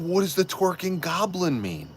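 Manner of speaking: high-pitched, incredulous question; baffled. Retold or imitated. Retells his baffled reaction.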